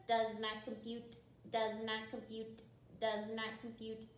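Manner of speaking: neutral machine monotone; robot voice. Shown as a demonstration. Teacher doing the verse with kids, robot voice. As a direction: chant it evenly as a robot. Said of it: "Practice your robot voice".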